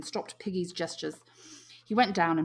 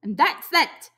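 high, louder, final; stubborn; 10 semitones higher, 9 dB louder, slower than usual. A narrator's reading. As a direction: close the matter. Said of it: "said Piggy stubbornly".

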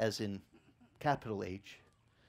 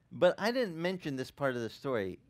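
ordinary delivery

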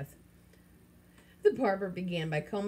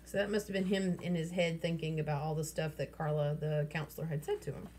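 usual speaking voice

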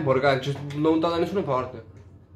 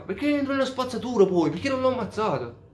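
much higher-pitched, incredulous questions; baffled outburst; 10 semitones higher than usual. Real, not acted. Player watching someone get into the garbage truck, baffled outburst. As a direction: pitch shoots up in bewilderment.

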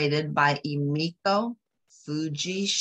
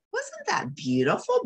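delighted aside. Real, not acted. Reader delights in scene.

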